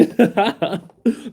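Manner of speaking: high giggle; laughing. Real, not acted. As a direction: burst out giggling. Laugh after a joke.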